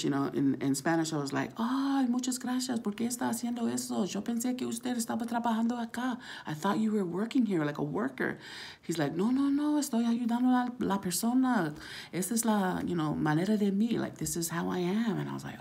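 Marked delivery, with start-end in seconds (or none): 1.6-8.4 retold thanks in Spanish. Quoting himself thanking in Spanish. 9.1-15.2 helper's reply. Retold or imitated.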